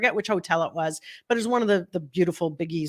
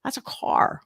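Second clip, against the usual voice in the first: whisper; slower than usual. Retold or imitated. Repeats stunned.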